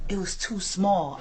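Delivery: slow, soft retold excuse; imitating sheepish excuse. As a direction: draw out the awkward admission quietly. Retold son's awkward excuse.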